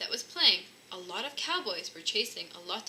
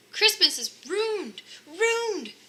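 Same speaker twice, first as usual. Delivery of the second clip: higher, louder, slow wail; wailing despair; 10 semitones higher, 6 dB louder, much slower than usual. A narrator's reading. Santa wailing about his missing suit, hammed-up despair. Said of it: "cried Santa".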